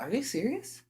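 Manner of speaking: very high disbelieving squeak; mock high-pitched surprise; incredulous girl. Girl reads the note.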